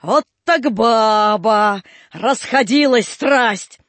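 slow, drawn-out marvelling bystander voice; gawking onlooker. A narrator's reading. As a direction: gossip at the gate, amazed. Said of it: "говорили у ворот".

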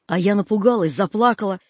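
plain reading voice

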